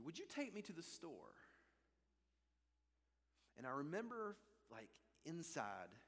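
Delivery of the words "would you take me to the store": higher-pitched imitation of a little lady; Irish lady's request. Irish lady asking for a ride to the store, high little voice. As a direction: lightly put on her high, asking voice. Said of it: "She said".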